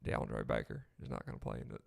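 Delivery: low, drawn-out Southern coach drawl; a deep Alabama coach drawl, lightly held; Gus Malzahn drawl. Retold or imitated. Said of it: "that's more like Gus Malzahn".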